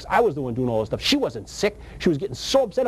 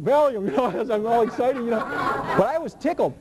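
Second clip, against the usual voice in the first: laughing; 5 semitones higher than usual. Real, not acted. Laughing while retelling excitement.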